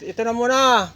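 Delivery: calling out. Real, not acted. Calling to someone to move.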